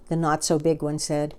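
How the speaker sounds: ordinary delivery, nothing marked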